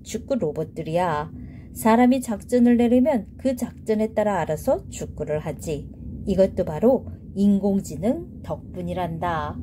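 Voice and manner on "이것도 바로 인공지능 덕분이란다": plain reading voice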